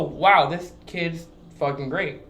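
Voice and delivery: usual speaking voice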